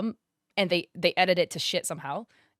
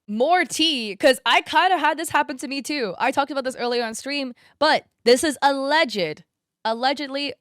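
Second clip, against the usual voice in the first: excited; 6 semitones higher, 5 dB louder than usual. Real, not acted. Spilling tea excitedly to chat.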